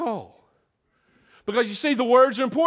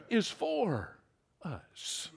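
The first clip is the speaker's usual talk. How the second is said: very soft, fading to a whisper on "us"; hushed climax; 3 semitones lower, 12 dB quieter, much slower than usual. Real, not acted. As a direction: let it fall away slowly, word by word. Hushed landing after preaching climax.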